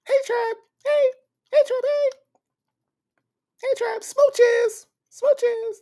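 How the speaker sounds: very high, sweet, sing-song greetings and smooches; squeaky-high playful greeting, blowing kisses; V Lenore voice. Retold or imitated. Doing a viewer's imagined voice for the chat, very high and sweet. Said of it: "that's my V Lenore".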